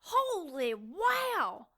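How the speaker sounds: drawn-out, awestruck exclamation; amazed. Acted. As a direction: marvel slowly, stretching both words. Amazed at the healing wound.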